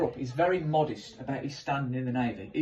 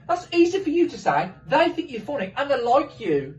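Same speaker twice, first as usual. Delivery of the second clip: high, loud nervous protest; panicky whine; 10 semitones higher, 6 dB louder than usual. Retold or imitated. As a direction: squeak it out in a panic.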